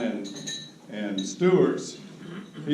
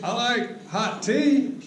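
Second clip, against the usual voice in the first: higher, slow, lightly mimicking a guileless young man; a light mock-innocent drawl, higher; imitating Terry; 9 semitones higher, slower than usual. Retelling the new partner's surprising reply, lightly imitated, higher and slow.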